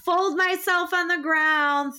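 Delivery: ordinary talk, nothing marked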